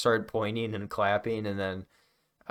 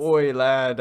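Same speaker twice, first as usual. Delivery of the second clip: put-on British accent; 3 semitones higher, 7 dB louder than usual. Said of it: "excellent British accent".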